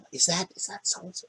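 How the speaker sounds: hushed whisper. Acted. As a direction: whisper it in awe. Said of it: "people would kind of whisper under their breath".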